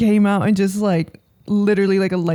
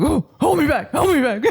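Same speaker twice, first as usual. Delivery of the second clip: higher, hammed-up fake bravado; mock hold me back; 6 semitones higher than usual. Friend retells facing the angry neighbor, mock bravado. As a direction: play at needing to be held back, jokingly. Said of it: "I was a little like".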